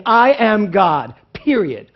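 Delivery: I AM GOD slow and loud, each word weighted, then a firm "period"; "I am God" as a slow, ringing statement; emphatic declaration. Shown as a demonstration. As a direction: declare "I am God" loudly and squarely, no doubt in it. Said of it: "cutting through all the crap and just saying".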